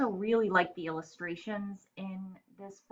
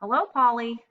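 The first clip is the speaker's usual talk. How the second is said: bright, higher friendly lizard hello; lizard greeting; 6 semitones higher, 6 dB louder than usual. Acted. Lizard greets the parrot, bright and friendly. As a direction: call out cheerfully as the lizard. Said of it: "said the lizard".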